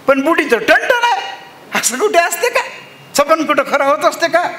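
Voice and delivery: high, light voice with a laugh; wife laughing it off. Acted. Wife laughs off her husband's dream. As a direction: brush the dream aside.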